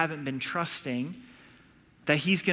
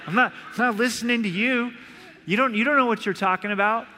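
laughing mock brush-off; 5 semitones higher, 4 dB louder, faster than usual. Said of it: "You know".

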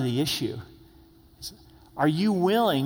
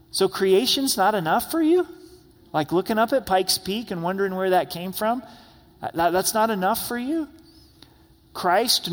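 rising, higher disbelief climbing to "that's not enough for you?"; incredulous challenge; 3 semitones higher than usual. Real, not acted. Preacher challenging those wanting signs, incredulous and climbing. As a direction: push each question higher in disbelief.

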